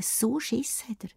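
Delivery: hushed whisper of the wolf's fright; whispered fear. A narrator's reading. Narrator whispering to children about the scared wolf. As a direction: breathe it like a secret.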